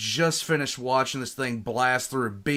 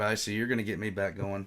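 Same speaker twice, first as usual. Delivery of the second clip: ordinary delivery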